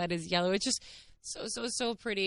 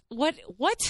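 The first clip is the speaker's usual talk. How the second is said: high, repeated incredulous question; stunned disbelief; 5 semitones higher than usual. Real, not acted. Vlogger marvels to viewers at his planning, stunned disbelief. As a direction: pitch it up, astonished. Disbelief at calligraphy card and flowers.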